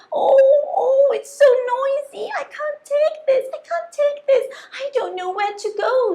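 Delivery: high, loud wailing cry; overwhelmed by the noise and panicking; distressed whale. Acted. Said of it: "says the whale".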